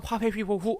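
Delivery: 화페피포후처럼 F를 ㅎ 소리로; "화페피포후"를 ㅎ으로 잘못 발음하는 예시. Shown as a demonstration. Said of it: "하시면 안 되겠죠". (F 대신 ㅎ을 넣은 화페피포후)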